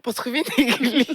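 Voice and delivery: high, loud amused disbelief; incredulous. Retold or imitated. Speaker replays her reaction to God suggesting TikTok for church, incredulous. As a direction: question it as if it were absurd, pitch lifted.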